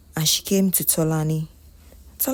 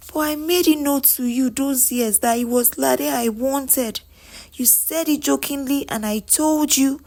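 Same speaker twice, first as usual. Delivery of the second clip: high-pitched, emphatic self-defence; insistent protest; 8 semitones higher than usual. Acted. Ebuka reminding her he wanted Lade, protesting. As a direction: argue the point insistently, pitch held up throughout. Man defends his past choice.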